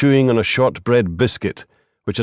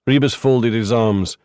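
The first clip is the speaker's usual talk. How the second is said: ordinary delivery, nothing marked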